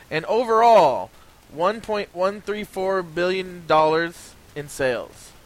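slow, weighty figures read out; slow announcement. Acted. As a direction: read the totals slowly, gravely. Slow dramatic reading of totals.

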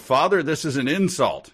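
a prince protesting, not quite shouting; mild mock yell. Prince protests to his father, mild mock yell. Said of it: "He yelled".

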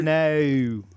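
drawn-out Australian vowel; Australian accent. Shown as a demonstration. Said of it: "Oh, wait, no, that is Australian".

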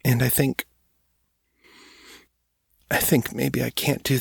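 a teary voice on the edge of crying; tearful. Acted. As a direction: let the tears come through as she speaks. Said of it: "Two tears ran down her cheeks".

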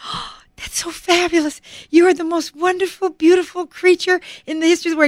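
high, gushing, over-the-top praise. Retold or imitated. Mother gushing praise over her daughter, high and breathless. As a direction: lay the compliments on thick and fast.

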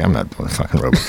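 low, soft, under the breath; muttered refusal. Retold or imitated. Actor refusing to do the robot, low and muttered. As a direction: grumble it quietly. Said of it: "I'm like".

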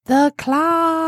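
high, drawn-out chant; mock awe. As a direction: intone it like an awestruck toy. Mock awe at claw-like hand.

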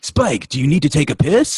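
high, loud cartoon robot. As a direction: call out like a cartoon Autobot. Voicing a Transformer to Spike.

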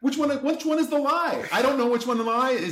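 retold protest climbing very high; exasperated outrage. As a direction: act out baffled indignation, pitch climbing. Retells outraged reply in argument.

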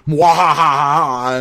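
slow, drawn-out evil cackle; evil villain laugh. Retold or imitated. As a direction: cackle slowly like a cartoon villain. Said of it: "Evil J D F laugh".

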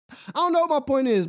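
laughing through the words at himself. Real, not acted. Host laughing at himself for losing his point, laughing. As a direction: chuckle as the point slips away.

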